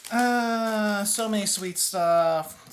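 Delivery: loud, drawn-out exclamation; exclaiming. Real, not acted. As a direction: stretch it out, overwhelmed. Overwhelmed by sweets.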